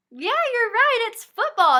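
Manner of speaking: high, loud cheer for a right answer; light up like the child just got it; teacher cheering a child's correct guess, bright and loud; excited praise. Pretend.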